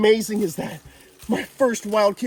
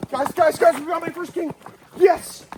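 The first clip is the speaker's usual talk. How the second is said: excited shouting; 9 semitones higher than usual. Real, not acted. Finding his first king cobra, thrilled shouting. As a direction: call out to the viewers like you just struck gold.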